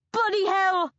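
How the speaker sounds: high, loud startled yell. A narrator's reading. Bea yells as she bolts down the stairs. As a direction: bellow it in shock. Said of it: "I yell".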